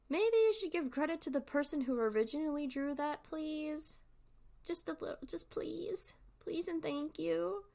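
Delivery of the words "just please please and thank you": ordinary delivery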